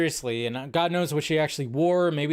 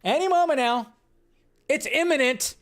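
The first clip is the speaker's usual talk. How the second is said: mocking scoopers; 11 semitones higher than usual. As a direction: parody the hype merchants. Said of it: "How many times did we hear from scoopers".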